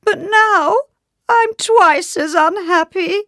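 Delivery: very high, loud, drawn-out misery; over-the-top tearful lament; wailing. A narrator's reading. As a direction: escalate into a piercing royal wail. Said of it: "he wailed".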